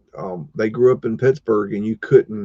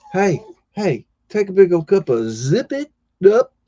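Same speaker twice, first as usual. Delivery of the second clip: higher-pitched scolding call; calling out sharply; 8 semitones higher, 4 dB louder than usual. Real, not acted. Owner tells noisy hound to zip it, scolding call. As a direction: call out to hush the dog.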